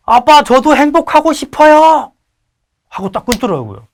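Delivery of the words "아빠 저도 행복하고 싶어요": son's punchline. Retold or imitated.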